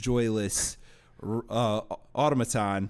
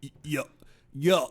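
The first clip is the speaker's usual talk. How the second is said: strained fake American accent. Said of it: "that's exactly how he talks for two full hours".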